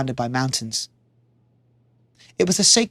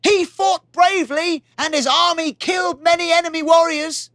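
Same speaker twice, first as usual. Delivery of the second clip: much higher, far-off messenger voice; 11 semitones higher than usual. Acted. Messenger reporting the battle to the king, formal. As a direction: announce it like a herald. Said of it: "said the messenger".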